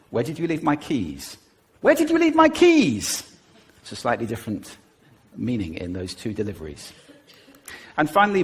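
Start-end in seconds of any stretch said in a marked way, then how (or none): 1.8-3.2 very high, loud and frantic; high agitated pitch. Shown as a demonstration. Partner demanding the keys in rising panic. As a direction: voice leaps up on "my keys". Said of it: "you can do it just with pitch".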